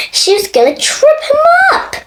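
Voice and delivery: high and fast; excited. A narrator's reading. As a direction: rush it up high. Excited storytelling.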